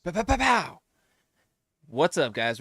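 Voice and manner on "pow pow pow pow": high, loud pow pow like shots; gunshot noises. Pretend. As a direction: blast out playful gun sounds. Imitating gunshots for fun.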